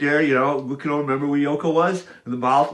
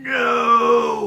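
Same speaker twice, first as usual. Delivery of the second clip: a single "No" thrown much higher and louder; imitated ghostly protest; 10 semitones higher, 6 dB louder than usual. Ghost of John calls to his son, high and alarmed. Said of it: "the mouth of John going".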